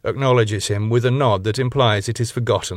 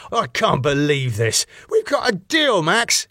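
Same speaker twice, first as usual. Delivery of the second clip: openly angry; 7 semitones higher than usual. Acted. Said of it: "openly angry".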